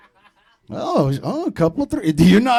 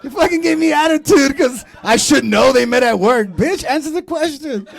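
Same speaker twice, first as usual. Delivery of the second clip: mock outrage; 7 semitones higher, 6 dB louder than usual. Mock outrage at audience member.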